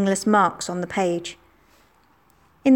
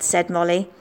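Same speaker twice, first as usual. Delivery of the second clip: usual speaking voice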